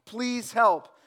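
high, loud desperate call upward; man hanging from a cliff yells for help; yelling for help. Retold or imitated. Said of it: "he begins to yell".

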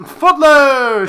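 big loud high reveal of the title; excited announcement. Acted. To Jake, revealing the show they saw: loud excited announcement.

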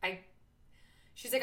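ordinary delivery

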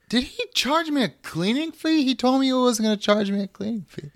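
high whiny complaint; indignant customer griping later; complaining customer. Acted. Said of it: "Cuts to later that night".

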